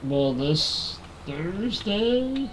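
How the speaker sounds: ordinary talk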